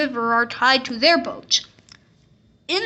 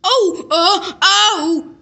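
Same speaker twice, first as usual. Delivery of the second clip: put-on high, loud child's yelp; a boy yelling in surprise and pain; little boy yelling; 6 semitones higher, 3 dB louder, slower than usual. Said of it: "yelled the little boy".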